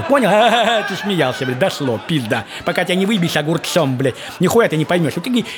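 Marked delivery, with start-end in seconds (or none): none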